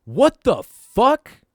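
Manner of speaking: drawn-out startled yell, high and loud; mock yell from passing car. A stranger yells from a speeding car, drawn out and loud. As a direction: stretch it out as a shocked shout. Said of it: "very audibly say".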